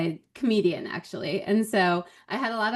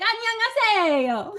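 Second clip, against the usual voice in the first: put-on high, fast, sing-song Valley Girl greeting; Valley Girl Korean; 13 semitones higher, much faster than usual. Mimicking the host demanding Valley Girl Korean. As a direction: chirp it like a ditzy Valley Girl. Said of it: "she has to be more like".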